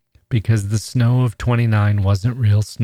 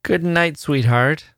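higher, absent-minded goodnight; vague; 7 semitones higher, slower than usual. A narrator's reading. Charlie to Honoria, vague and distracted. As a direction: drift through it, half elsewhere. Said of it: "he said vaguely".

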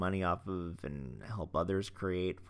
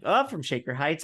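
knowing mock remark; 7 semitones higher, 6 dB louder than usual. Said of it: "as if I know anything about".

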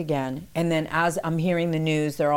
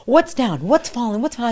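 higher and louder, frantic; confused shouting; 7 semitones higher, 5 dB louder than usual. Retold or imitated. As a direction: demand to know what happened.

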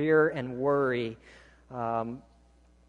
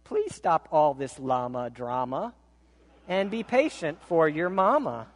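normal talking voice

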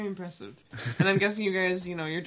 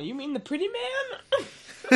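drawn-out, pitched-up goofy question; high, slow silly voice; 12 semitones higher, 3 dB quieter, slower than usual. Acted. Joke line, all laugh.

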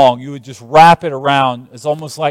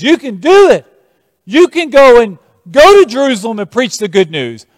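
encouraging; 7 semitones higher, 6 dB louder than usual. Acted. Preacher voicing prophet encouraging Paul.

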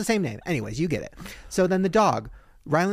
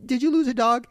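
high, eager question to a stranger; eager call; 10 semitones higher than usual. Retold or imitated. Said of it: "be like".

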